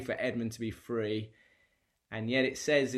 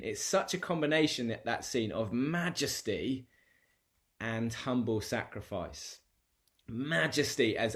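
ordinary talk, nothing marked

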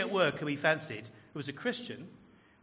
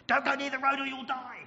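high, loud frantic warning; mother's yell; 11 semitones higher, 6 dB louder than usual. Acted. Mother yelling at her overactive toddler, urgent yell. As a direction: yell it in one high burst. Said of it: "a mother who yells at her overactive toddler".

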